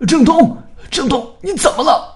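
very high, loud cry of panic; alarmed scream. A narrator's reading. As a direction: scream a friend's name in horror. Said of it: "传来了一声惊叫". (惊恐尖叫)

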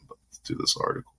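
whispered aside. Retold or imitated. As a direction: say it under your breath. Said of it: "was like".

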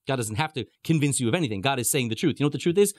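usual speaking voice

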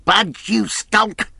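high, slow, outraged shout; furious villain's yell; scornful cry. A narrator's reading. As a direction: throw the word back with contempt. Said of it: "cried Pew".

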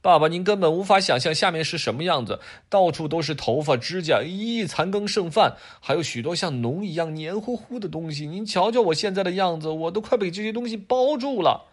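put-on a boy bragging about the slime; excited kid. Adam telling dad about the drain, high excited child. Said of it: "亚当看着爸爸说".

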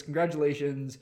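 normal talking voice

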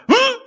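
high, loud muffled hum; closed-lip mumble. Retold or imitated. Silenced man whose lips never parted, muffled mumbling. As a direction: mumble through sealed lips. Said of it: "all he could do for the next half hour was mumble".

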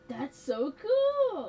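a gasp, then a loud, high, slow "so cool" in mock delight; hammed-up gasp of fake amazement. Teasing his friend about both liking applesauce, hammed-up delight.

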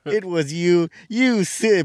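high and loud cartoon accusation; Scooby-Doo reveal. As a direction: shout the big cartoon reveal.

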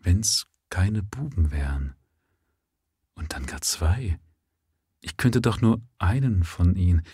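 waver over each word; hesitant. Acted. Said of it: "zögernd meinte sie".

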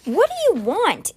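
high, startled demand. A narrator's reading. Aaron to the brother who burst in, demanding. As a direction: demand it sharply, pitched well up. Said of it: "Aaron demanded".

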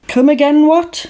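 high-pitched, disbelieving query; mock incredulity. Host reacts to what nobody reacts to, mock incredulity. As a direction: demand a repeat in mock disbelief.

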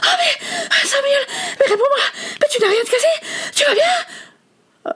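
high, frantic, near tears; panicked and breathless. A narrator's reading. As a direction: cry out in alarm for a friend. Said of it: "Essoufflé et affolé".

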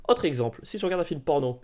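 usual speaking voice

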